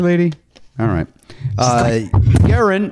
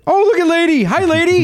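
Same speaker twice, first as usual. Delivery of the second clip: pet talk; 11 semitones higher than usual. Real, not acted. Greeting the dog on camera.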